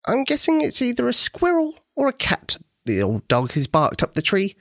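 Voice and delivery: a hint of a gentle granny musing; kindly old granny. A narrator's reading. Granny Dear voiced in story.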